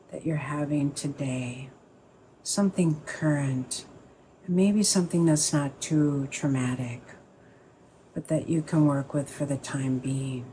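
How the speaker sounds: calm, unhurried meditation guide, pausing between phrases; guided meditation. Acted. Teacher easing the group into a sit, guided meditation voice.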